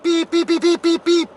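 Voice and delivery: high, steady monotone beeping; imitated censor bleeps. Man censoring his own cursing, high bleeps. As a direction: mimic the bleep over swear words. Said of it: "like that".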